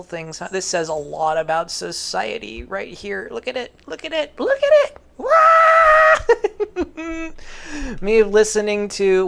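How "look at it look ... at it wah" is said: much higher, rising into a loud squeal; building from fast chatter to a loud squeal; excited shriek. Real, not acted. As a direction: lose it over the picture. Excited shout at a picture.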